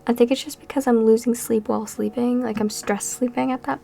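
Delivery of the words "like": ordinary talk, nothing marked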